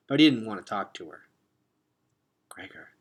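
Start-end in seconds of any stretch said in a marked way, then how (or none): 2.5-2.9 soft, sad, hushed name; soft sorrowful murmur of his name; sad whisper. A narrator's reading. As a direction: say his name gently under your breath. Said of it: "she whispered sadly".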